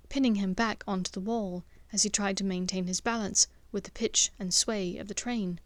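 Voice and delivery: usual speaking voice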